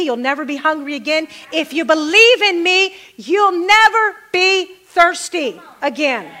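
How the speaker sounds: rising and falling preacher cadence; preaching. Acted. Pastor preaching to his congregation about Jesus, fervent sermon delivery. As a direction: preach it to the room with fervor.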